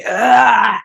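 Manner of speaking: high, loud mocking scorn with a fake gag; mock disgust. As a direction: sneer the affirmations like they make you sick. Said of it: "I was getting angry because I was like".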